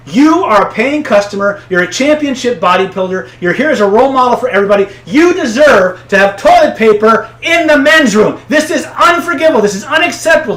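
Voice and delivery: high, loud, righteous tirade; indignant outrage. Retold or imitated. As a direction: rant on his behalf like a furious ally. Clerk echoes rage as ally.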